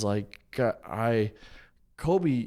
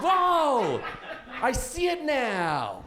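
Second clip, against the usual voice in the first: mock realization; 6 semitones higher, much slower than usual. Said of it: "And I was like".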